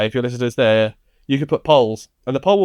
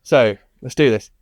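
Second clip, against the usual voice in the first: ordinary talk, nothing marked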